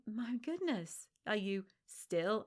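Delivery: slow, drawn-out "still" with heavy emphasis; stress on still. Retold or imitated. Smug friend mocking, heavy stress on still. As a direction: lean hard on "still", mocking disbelief. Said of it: "massive emphasis on the word still".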